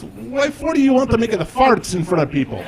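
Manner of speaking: dad baffled by his son's plan; Italian dad accent. Retold or imitated. Dad questioning Bruno, high puzzled Italian accent. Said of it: "He goes".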